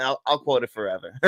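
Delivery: ordinary delivery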